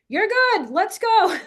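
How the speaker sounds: mock impatient parent; dismissive parent. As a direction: hurry the kid along. Rushed parent.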